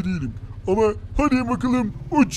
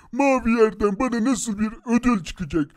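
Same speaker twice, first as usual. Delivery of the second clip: ordinary talk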